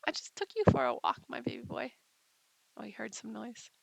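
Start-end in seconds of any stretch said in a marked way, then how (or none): 0.1-1.9 mock scolding. Real, not acted. Scolding her dog affectionately.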